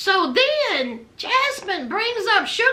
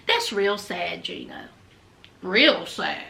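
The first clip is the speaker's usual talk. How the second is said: low, soft pitying tut; dropping low to pity Gino; mock pity; 13 semitones lower, 5 dB quieter than usual. Real, not acted. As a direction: shake your head at him.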